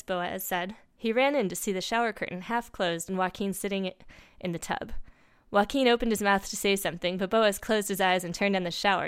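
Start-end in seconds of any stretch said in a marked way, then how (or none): none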